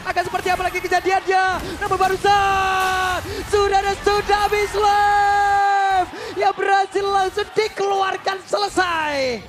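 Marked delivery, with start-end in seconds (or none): 1.8-9.4 loud, slow, dragged-out shout; excited call. Real, not acted. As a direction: sell the ultimate. Caster calls teamfight ult.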